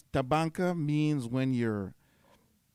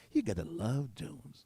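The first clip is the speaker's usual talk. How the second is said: slow, softer imitation of an American accent; 6 dB quieter than usual. Quoting an American friend to listeners, in an American accent. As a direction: draw it out in a put-on American accent. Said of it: "American accent".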